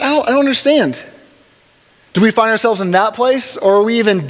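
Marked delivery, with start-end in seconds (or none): 0.0-1.0 much higher, mock bewildered; baffled. Mocking the Facebook post, high mock bewilderment. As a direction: play dumb, pitched high.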